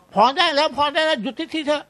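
high, loud fed-up yell; annoyed shout. Retold or imitated. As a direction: shout at the sheikh to stop reading. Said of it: "ก็ตะโกนขึ้นมาแบบว่า". (ตะโกนเสียงสูงอย่างรำคาญ)

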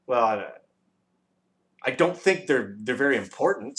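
usual speaking voice

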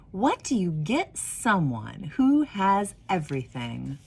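gentle storybook reading voice; warm, unhurried storyteller; reading to children. Acted. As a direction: read it like a picture book to kids at story time. Reads picture book aloud.

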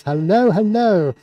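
ordinary talk

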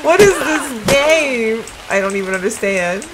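ordinary talk, nothing marked

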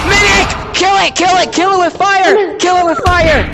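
high, urgent battle yell; high-pitched shouted alarm; panicked shouting. Real, not acted. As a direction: scream orders at the team as a monster appears. Monster appears, urging team to attack.

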